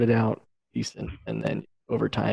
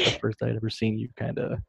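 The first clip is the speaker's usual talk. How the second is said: ordinary delivery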